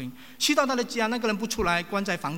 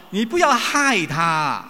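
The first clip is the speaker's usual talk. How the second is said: slow, louder warning; retold rebuke; 2 semitones higher, 9 dB louder, much slower than usual. Relatives warning the preacher, slow loud rebuke. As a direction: lean hard on each word like a family scolding. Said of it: "这句话是什么？".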